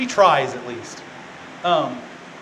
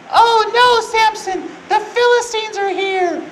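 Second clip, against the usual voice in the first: very high, loud damsel cry; mock alarmed yell; 6 dB louder, slower than usual. Delilah warning Samson of the Philistines, mock alarmed yell. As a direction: shriek the warning in drawn-out fake panic. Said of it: "and she yells".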